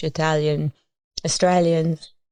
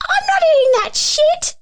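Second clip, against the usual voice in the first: shrill, far higher, loud outburst; loud blurt; 6 dB louder than usual. Retold or imitated. Naive Australian blurting his refusal at the table, loud and high. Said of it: "like, so loud".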